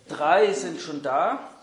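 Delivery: usual speaking voice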